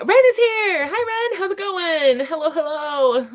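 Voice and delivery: high-pitched squealed welcome; excited greeting. Real, not acted. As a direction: greet a friend who has just turned up, thrilled. Greets arriving viewer excitedly.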